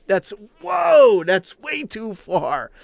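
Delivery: mock shock.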